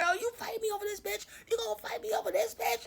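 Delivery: high, fast imitated protest; mock outraged girlfriend. Girlfriend fighting her man on the stairs, mock high outrage. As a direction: squeal it quickly, as if mid-tussle.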